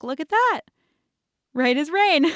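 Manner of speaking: a hint of a cheerful dad showing off his work; dad's voice. Dad to his daughter in a bag suit, lightly voiced cheerful. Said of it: "and he was like".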